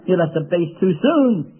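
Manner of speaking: ordinary talk, nothing marked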